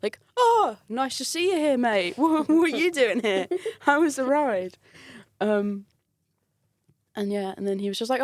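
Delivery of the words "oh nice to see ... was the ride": higher-pitched fake-casual greeting; hammed-up pleasant surprise; feigned surprise. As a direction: act as if bumping into them by chance. Faking surprise at engineered meeting.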